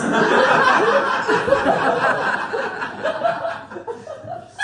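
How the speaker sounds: high, loud burst of laughter; shrieking laugh. Real, not acted. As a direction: cackle at the creepy line. Laughing at her own story.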